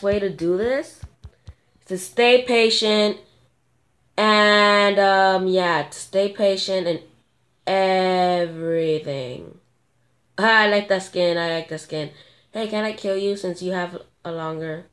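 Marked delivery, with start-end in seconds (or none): none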